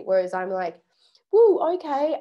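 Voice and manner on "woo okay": high, loud excited whoop; excited woo. Retold or imitated. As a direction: cheer it eagerly. Eager yes to a plan.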